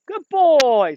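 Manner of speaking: high, loud praise for the dog; happy dog praise. Real, not acted. Trainer praising Solo for the duck, high happy praise. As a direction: reward him with your voice.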